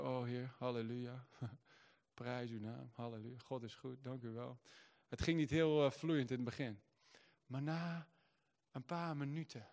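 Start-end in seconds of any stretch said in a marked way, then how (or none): 0.0-4.6 very soft, halting halleluja; hesitant praise. Retold or imitated. Lying on the floor, he softly starts praising. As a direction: barely murmur the first praise. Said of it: "Zo langzaam begon ik zo".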